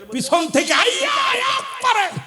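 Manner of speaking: sudden high, drawn-out cry; full-force preaching cry. Acted. Preacher acts out the attack from behind, soaring cry. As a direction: let the attack ring out high.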